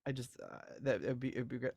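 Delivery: normal talking voice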